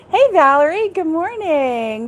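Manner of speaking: ordinary talk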